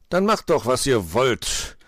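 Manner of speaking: long-drawn, sighing surrender; resigned sigh. A narrator's reading. Gnome giving in to friends before certain defeat, resigned sigh. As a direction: give in with a heavy, slowing sigh. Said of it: "seufzte der Wichtel in Erwartung".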